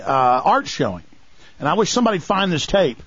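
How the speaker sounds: ordinary delivery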